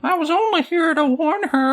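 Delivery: very high, squeaky clown-mime voice; Mister Mime voice. Acted. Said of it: "That's my best Mister Mime voice".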